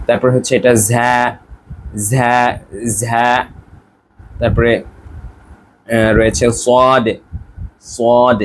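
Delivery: each hard Arabic letter said twice, slow; teacher drilling the difficult letters; special Arabic letters. Shown as a demonstration. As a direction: repeat every special letter with the true Arabic sound. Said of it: "আলাদা করে দেখাচ্ছি".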